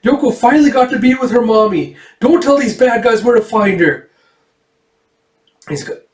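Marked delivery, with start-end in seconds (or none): 0.0-4.1 put-on high, loud childish plea; girl pleading not to tell the bad guys; defiant little girl. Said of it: "And she's like".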